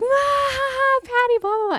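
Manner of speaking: high-pitched imitation of someone cracking up loudly; mock explosive laughter. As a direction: mimic her shrieking with laughter through the words. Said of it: "she just completely explodes in laughter".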